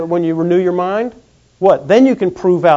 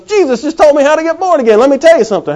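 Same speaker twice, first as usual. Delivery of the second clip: higher, louder excited announcement; hammed-up excited corpse, higher; excited risen corpse; 8 semitones higher, 4 dB louder than usual. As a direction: burst out high like a man jumping off the table. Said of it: "the guy stands up and goes".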